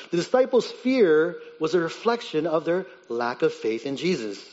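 normal talking voice

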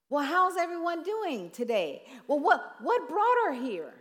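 chirpy tactless visitor; mock cheery chaplain. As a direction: play the chaplain who misreads the room. Mocking the clueless chaplain.

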